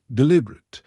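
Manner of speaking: ordinary delivery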